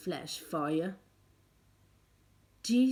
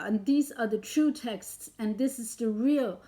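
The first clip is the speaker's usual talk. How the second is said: normal talking voice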